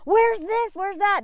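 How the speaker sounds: mock a fussy American tourist; whiny American. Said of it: "you're like".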